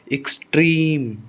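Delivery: Extreme slowly, trim long and stressed; stress on second syllable. Shown as a demonstration. Teacher repeating the exception, extreme stressed on trim. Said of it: "stress second syllable पर आता है".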